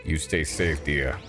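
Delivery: lightly put-on low voice; low imitation of a game farewell; mimicking game character. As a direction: echo the farewell in a low mock voice. Echoing the game character's line.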